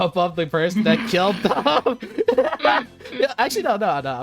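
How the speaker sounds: ordinary talk, nothing marked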